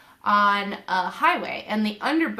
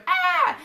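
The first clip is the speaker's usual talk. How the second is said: a sharp high startled cry of panic; 10 semitones higher, 5 dB louder than usual. Retold or imitated. Waking up panicked about the baby, a high loud cry. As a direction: cry out high and loud, jolted awake in fear.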